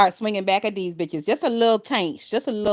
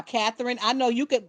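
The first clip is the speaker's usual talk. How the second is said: ordinary delivery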